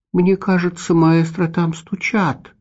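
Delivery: high, innocent little cat voice; simple-hearted remark. A narrator's reading. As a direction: point it out innocently like a small cat. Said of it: "простодушно заметил".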